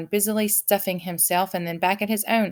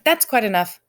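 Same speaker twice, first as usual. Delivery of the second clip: louder sharp cutoff; snapping; 3 semitones higher, 7 dB louder than usual. A narrator's reading. King snapping at Milo mid-speech. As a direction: cut him off short. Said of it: "snapped the king".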